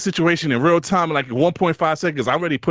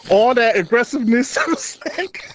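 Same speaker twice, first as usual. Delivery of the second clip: name broken up by a laugh; 6 semitones higher, slower than usual. Real, not acted. Telling his friend the story, cracking up. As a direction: laugh right through the word.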